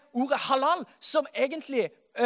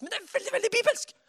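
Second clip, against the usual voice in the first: excited; 8 semitones higher, faster than usual. Acted. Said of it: "dette er jo veldig lite norsk".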